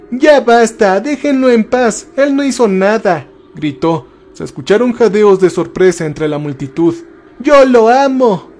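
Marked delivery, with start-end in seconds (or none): 0.1-3.3 high-pitched plea, mildly shouted; emotional cry. A narrator's reading. Jessica begs the mob to leave him alone, crying out mildly. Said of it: "gritó emocionada". 7.4-8.5 high, drawn-out outcry; cried-out declaration. A narrator's reading. Jessica confesses love before the whole crowd, crying out. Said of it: "ella gritó".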